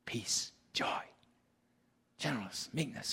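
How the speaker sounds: breathy whispered word list. Real, not acted. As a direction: whisper the words tenderly.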